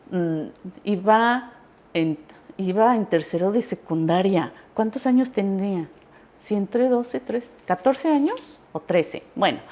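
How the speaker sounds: usual speaking voice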